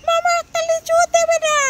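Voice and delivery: put-on high squeaky-pitched pleading child. Child asking uncle for a lychee, high child voice. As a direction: pipe up high like a begging child.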